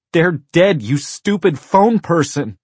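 put-on high, very loud child's yell; furious little-girl shout. A narrator's reading. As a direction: scream it into the phone. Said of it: "Rachel shouted into the phone".

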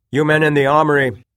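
loud, high ringing call; shouting. A narrator's reading. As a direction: call across the hall. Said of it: "he shouted".

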